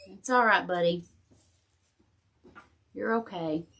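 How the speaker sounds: soft, reassuring words to a pet; calm the whining dog gently; tender comfort for an upset dog; soothing the dog. Real, not acted. Soothing her whining dog Jack.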